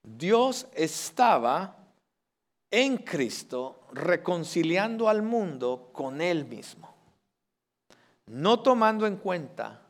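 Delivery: each word weighed out slowly; slow it right down so the words sink in; slow, emphatic rereading of the verse; slow and deliberate. Shown as a demonstration. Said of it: "Escuche bien esto".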